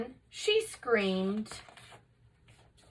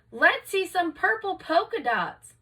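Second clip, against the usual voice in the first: put-on much higher, eager kid's call; yelled out; 8 semitones higher than usual. A narrator's reading. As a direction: call it across the classroom. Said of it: "One yelled out".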